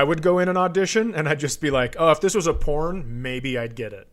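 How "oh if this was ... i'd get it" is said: ordinary talk, nothing marked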